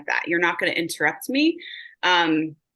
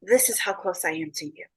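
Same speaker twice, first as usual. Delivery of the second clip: softer, hushed loving words; tender repeat; 2 semitones lower, 5 dB quieter than usual. Retold or imitated. Medium voicing the mother's tender closeness, hushed. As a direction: softly reassure as a mother holding close. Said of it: "and be like".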